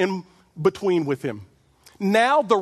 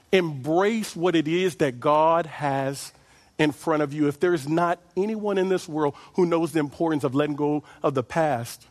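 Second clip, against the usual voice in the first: ordinary talk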